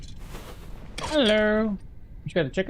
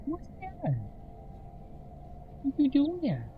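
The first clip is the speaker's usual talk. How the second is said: pet talk voice; 7 semitones higher, 13 dB quieter than usual. Real, not acted. Streamer cooing at the pet bird, high baby talk.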